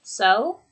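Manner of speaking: "So" rising at the end; interested lift on "So"; engaged prompt. Shown as a demonstration. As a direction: say "So" as an upturned question. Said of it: "And that indicates engagement".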